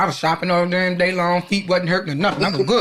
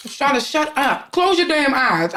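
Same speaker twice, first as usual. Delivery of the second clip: higher, slow exasperated scold; mock annoyed friend; 7 semitones higher, slower than usual. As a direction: tell her off like a tired friend. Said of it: "Keith said".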